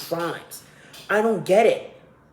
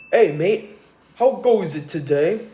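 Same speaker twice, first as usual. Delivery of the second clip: loud, hammed-up British accent; mock British accent; 3 semitones higher, 5 dB louder than usual. Podcaster showing how British people sound, mock British accent. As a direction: do a caricature British greeting. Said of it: "British people basically sound like toddlers".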